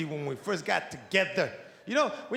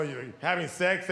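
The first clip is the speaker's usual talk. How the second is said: ordinary delivery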